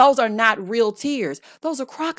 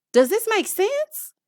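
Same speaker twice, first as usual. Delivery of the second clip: higher, slow, disbelieving question; incredulous; 11 semitones higher, slower than usual. Real, not acted. Host asking viewers in disbelief, high and slow. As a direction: ask like you can't believe it.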